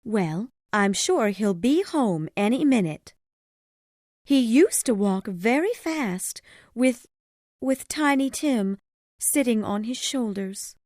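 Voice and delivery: high mother's voice, keeping up cheer; high, determinedly cheerful character voice; steady, cheerful mother. A narrator's reading. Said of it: "said in a steady, cheerful voice".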